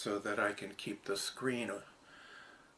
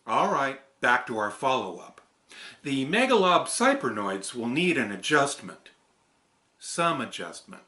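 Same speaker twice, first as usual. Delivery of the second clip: raised, louder character voice; character read; 2 semitones higher, 10 dB louder than usual. Acted. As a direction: perform the script line in a bright character voice. Retake of the character script.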